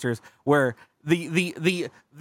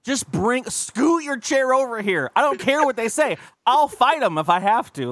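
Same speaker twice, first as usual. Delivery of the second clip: protective rallying; 9 semitones higher than usual. Retold or imitated. Calling lonely friend over, fired-up protective shout. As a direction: call the friend over, ready to fight. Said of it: "And I'm like".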